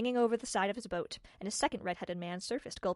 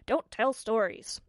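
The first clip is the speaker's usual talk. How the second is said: scolding adult; 7 dB louder, slower than usual. Retold or imitated. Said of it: "Everyone would just say".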